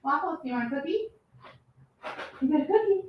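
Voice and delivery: high sing-song pet voice; calling a dog. Real, not acted. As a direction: coax the dog with a cookie.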